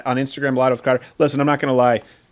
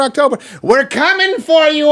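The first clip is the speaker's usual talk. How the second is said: excited shout; 10 semitones higher, 3 dB louder than usual. Real, not acted. Hyping audience about growth.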